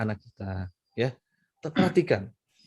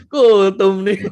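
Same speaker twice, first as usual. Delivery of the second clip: loud, high mocking call; loud, high teasing teenager; teasing teen; 5 semitones higher, 10 dB louder, much slower than usual. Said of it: "Langsung bilang".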